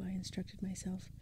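usual speaking voice

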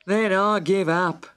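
very slow, pompous bird voice; solemn pelican. A narrator's reading. Pelican calls the next witness, slow and solemn. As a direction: drag out the order. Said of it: "the pelican".

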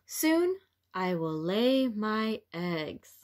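lively, expressive picture-book read-aloud; reading to children. A narrator's reading. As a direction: read it to little ones with wonder.

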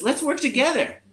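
high, lilting snake voice; snake camper. Acted. Snake rallying the campers, high-pitched voice. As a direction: pipe up in a high, encouraging voice. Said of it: "the snake says".

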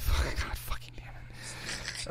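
slow unvoiced whisper; exasperated whisper. Real, not acted. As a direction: groan it under your breath. Exasperated at the crude joke.

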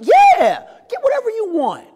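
high-pitched, gushing generosity; effusive welcome. Retold or imitated. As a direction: imitate a welcoming host urging a shy guest on. Acted mentor welcoming new student.

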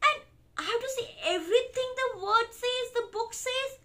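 high-pitched mock dismay; indignant realization. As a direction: protest at being forced to read it. Reader protests the book's rule.

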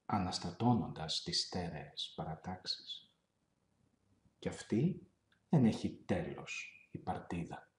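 solemn poetry reading; poem recitation. Acted. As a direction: recite it gravely, letting each line settle. Reads a Greek poem aloud.

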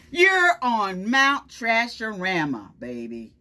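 cocky truck bragging about its stink; proud smelly truck. Acted. Truck taunting the kids, cocky and loud. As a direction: brag it out, teasing the listeners.

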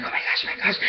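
hushed, voiceless retold fretting; whispered panic. Client waiting in the salon chair, whispered mock panic. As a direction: whisper it like a frantic private prayer.

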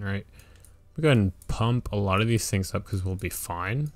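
usual speaking voice

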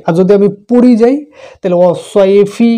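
ordinary talk

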